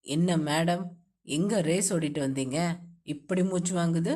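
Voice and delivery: low male voice, playful; husband teasing his wife, low playful voice. A narrator's reading. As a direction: tease her breathlessness in a low voice.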